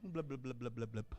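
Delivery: low bubbling blub sounds of sinking; mock underwater gurgling; soft low glug-glug of drowning; drowning gurgle.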